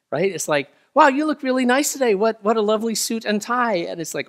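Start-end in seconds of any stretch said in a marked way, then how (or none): 0.9-3.8 high, loud, gushing praise; gushing compliment. Retold or imitated. Friend praising a new suit, high and gushing. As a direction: gush over the suit like an impressed friend.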